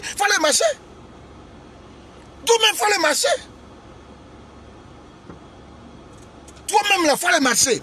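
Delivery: high squeaky mimic of the rival preachers; mocking imitation. Preacher mocking rivals who call for marches, high squeaky parody.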